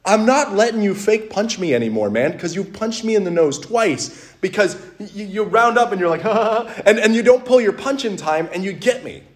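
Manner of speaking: fed up, imitated complaint; retold exasperated telling-off. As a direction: act out putting your foot down with a friend. Retold telling-off of a careless friend.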